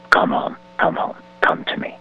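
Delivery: whispered call. Acted. Jesus softly calling lost listeners home, whispered.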